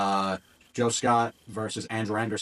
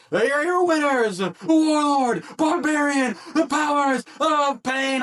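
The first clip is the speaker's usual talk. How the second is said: booming, drawn-out ring announcer call, much higher and louder; 6 dB louder, much slower than usual. Shown as a demonstration. Announcer to the arena crowd, booming winner call. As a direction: announce the winners like it's the main event. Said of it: "but I got to".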